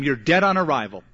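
ordinary talk

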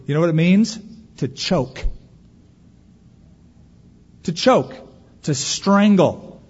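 ordinary delivery

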